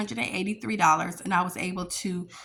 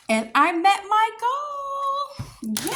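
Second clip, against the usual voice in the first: high, loud, slow excited cheer; 13 semitones higher, 4 dB louder, slower than usual. Real, not acted. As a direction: shout it with joy. Celebrates reaching savings goal.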